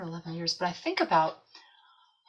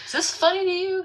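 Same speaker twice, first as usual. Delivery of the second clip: much higher, loud mock outrage; mock indignant; 13 semitones higher, 7 dB louder than usual. Asking the sky if the hard task is a joke, mock indignant. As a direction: demand it of the sky, half joking. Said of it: "I look up like".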